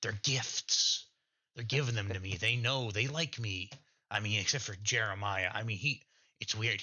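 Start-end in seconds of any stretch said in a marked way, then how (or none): 0.0-3.7 raised, self-satisfied hermit voice; a proud oddball who thinks the town adores him; Vulture, pleased with himself, talking to the party; lightly voiced eccentric Vulture.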